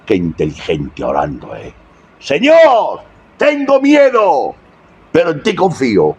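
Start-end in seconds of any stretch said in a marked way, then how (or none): 2.2-6.2 high, slow plea on tengo miedo; fearful plea. Acted. David praying to the Lord, a high frightened plea. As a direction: pray it like a frightened man clinging to God.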